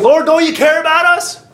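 high, alarmed plea; acted panic. Disciples on the boat call to sleeping Jesus, acted panic. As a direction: cry out as if about to die. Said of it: "They were gonna die".

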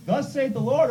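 proclamation. Retold or imitated. Quoting prophetic proclamation.

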